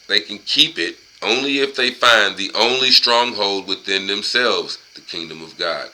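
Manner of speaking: emphatic, measured read-aloud; book reading. Acted. Man reading a book passage to listeners, emphatic read-aloud. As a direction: read it out firmly, word by word.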